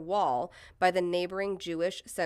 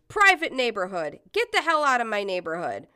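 raised, angry homeowner's shout; 4 semitones higher, 6 dB louder than usual. Retold or imitated. Homeowner ordering protesters away, shouting. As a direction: shout it to drive them off. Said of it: "he can be heard shouting".

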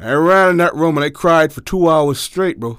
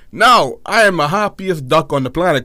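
ordinary delivery

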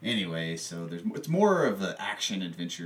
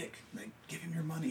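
fast, hushed whisper; urgent whisper; 7 semitones higher, 11 dB quieter, faster than usual. Retold or imitated. Sue urging Mick to hand over his money, in a fast whisper. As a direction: hiss it fast so the mugger won't hear.

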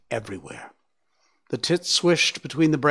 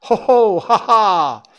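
higher, louder mocking laugh; booming laugh; 7 semitones higher, 9 dB louder than usual. The hunter calling out to the forest, a loud, menacing laugh. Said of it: "he called out".

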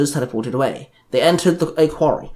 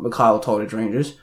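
plain reading voice

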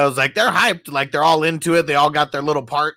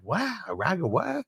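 confused; 7 semitones higher, 7 dB quieter than usual. Retold or imitated. Acting confused reply to oregano.

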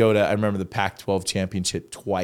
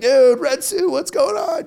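much higher, louder hyped fan call; excited fan shout; 4 dB louder, slower than usual. Retold or imitated. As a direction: call out like a thrilled fan in the stands. Fans shouting at the red suit.

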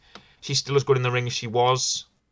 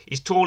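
tall lifted high and louder, rising; He's tall with a big rising stress on tall; emphatic repeat; 7 semitones higher, 4 dB louder than usual. Real, not acted. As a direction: push tall up high and loud.

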